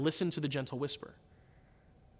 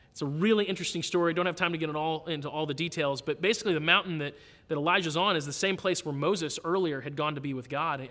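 ordinary delivery

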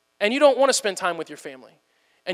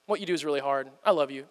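soft and much lower, soothing the wife; tender reply; 5 semitones lower, 4 dB quieter than usual. Acted. Husband tenderly soothing his tired wife at bedtime, soft and low. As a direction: gently comfort a tired wife. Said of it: "I could say".

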